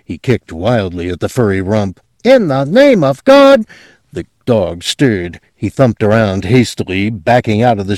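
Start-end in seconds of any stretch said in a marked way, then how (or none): 2.2-3.6 high loud exasperated cry; desperate high loud yell; frantic outburst. Acted. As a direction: shriek it at the lazy dog. Clerk kicking the sleeping dog.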